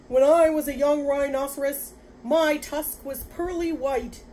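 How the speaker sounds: high, loud, wistful lament; woeful rhinoceros. Acted. Rhinoceros recalling its young tusk, woeful. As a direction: wail about lost youth, drawing out the end.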